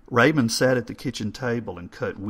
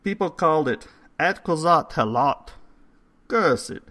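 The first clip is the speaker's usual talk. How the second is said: put-on higher, louder old foreign voice, scoffing; mock the idea like an old Hungarian; scornful old man; 8 semitones higher, 6 dB louder than usual. Old man tells his story.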